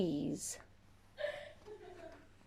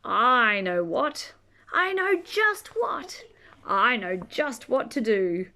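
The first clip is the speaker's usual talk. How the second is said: usual speaking voice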